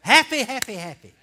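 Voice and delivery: loud, high happy chant building to a shout; rousing call-along. Real, not acted. Preacher leading the men to say it with him, rousing shout. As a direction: lead the men in chanting, ever bigger. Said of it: "Say that with me, men".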